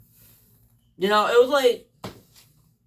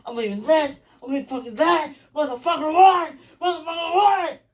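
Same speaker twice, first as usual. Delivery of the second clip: acted tantrum, climbing from usual pitch to high yells; getting madder; 3 semitones higher than usual. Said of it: "I was getting madder and madder".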